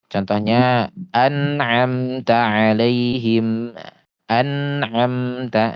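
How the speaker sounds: "An'amta" very slow with clear nasal n; clear sukun demo. Shown as a demonstration. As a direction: hold the n in "An'amta" smoothly. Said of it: "sukunnya ini dibaca jelas".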